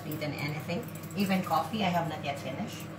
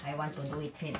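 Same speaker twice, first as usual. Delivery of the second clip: usual speaking voice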